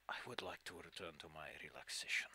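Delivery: hushed, sleepy whisper. Acted. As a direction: breathe it out as if dozing off. NPC ends the talk in a whisper.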